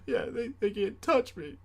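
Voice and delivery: put-on high, far kid's defiant bluster; tearful tough talk. A scared kid in brother's arms, high bluster. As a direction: bluster bravely through tears. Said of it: "last resort trash".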